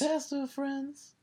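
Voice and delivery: high silly voice. Pretend. Echoing co-host in funny voice.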